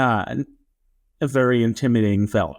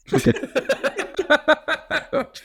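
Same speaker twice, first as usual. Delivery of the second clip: high laugh; 13 semitones higher than usual. Real, not acted. Laughing at own story.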